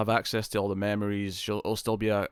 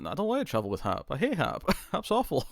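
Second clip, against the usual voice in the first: laughing mock whine; 7 semitones higher than usual. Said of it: "and she's like".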